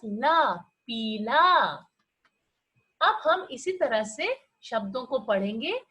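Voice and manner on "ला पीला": "ला, पीला" said slowly, each syllable drawn out; slow syllable reading. Shown as a demonstration. Teacher blending syllables into "पीला" for children. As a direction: sound out "ला" then "पीला" slowly. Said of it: "ल में आ की आवाज".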